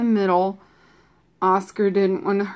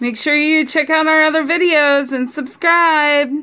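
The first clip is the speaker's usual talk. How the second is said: cheery sign-off; 7 semitones higher, 5 dB louder than usual. Real, not acted. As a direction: wave the viewers off brightly, loud and high. Signing off to viewers.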